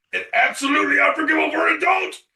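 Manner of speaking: mock-indignant parent, pitch soaring; outraged father. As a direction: build into a high, indignant rant. Reads father's outraged statement, acting.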